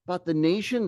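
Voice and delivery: ordinary talk, nothing marked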